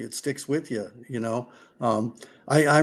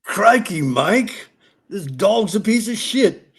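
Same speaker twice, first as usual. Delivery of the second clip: put-on broad, higher Aussie accent, drawn out slowly; Josh Strang Aussie accent; 6 semitones higher, 4 dB louder, slower than usual. Host asks guest for his best Aussie impression, broad Aussie accent. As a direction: do a cheeky Aussie rider, Australian accent. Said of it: "give me your best Aussie accent".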